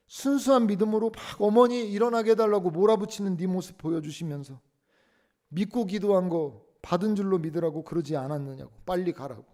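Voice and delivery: high, loud, angry scolding; scolding mother. Retold or imitated. Revived mother scolding her son for calling her back, loud. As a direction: shout down at the crying son.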